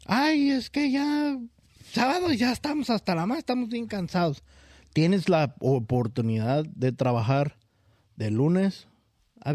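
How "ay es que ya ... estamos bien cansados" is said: quejido cansado. Retold or imitated. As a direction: whine like a worn-out coworker. Compañeros quejándose del sábado. (quejándose cansado, arrastrando las palabras)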